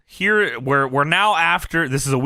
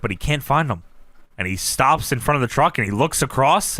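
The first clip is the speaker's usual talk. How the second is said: ordinary talk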